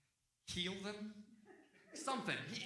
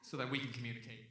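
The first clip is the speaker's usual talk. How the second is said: usual speaking voice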